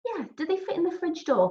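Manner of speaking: a lightly puzzled question at normal pace. Acted. Milky on the phone, puzzled at normal pace. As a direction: ask it as if the answer isn't obvious. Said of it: "sounding even more puzzled".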